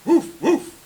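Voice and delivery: very high, loud little woofs; high-pitched storybook barking; dog bark. A narrator's reading. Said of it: "barked the dog".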